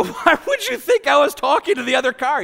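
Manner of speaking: high, loud, incredulous question; retold incredulity. Father retelling his question to his son, loudly incredulous. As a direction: act out a baffled dad demanding an explanation.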